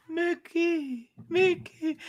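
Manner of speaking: high, soft Mickey call; a hint of Mickey Mouse; mock Mickey voice. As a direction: coo the name like a cartoon mouse. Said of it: "you'll be like".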